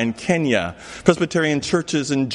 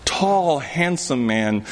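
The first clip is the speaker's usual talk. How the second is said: ordinary talk, nothing marked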